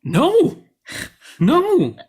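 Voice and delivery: high, loud "no"; emphatic denial. Real, not acted. Film fan rejecting cohost's guess, emphatic denial.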